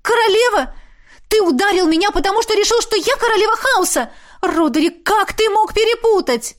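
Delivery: high-pitched indignant tirade; outraged disbelief. A narrator's reading. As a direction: cry out in hurt outrage. Outraged woman shouts at lover.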